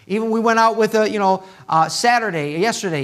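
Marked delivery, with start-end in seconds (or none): none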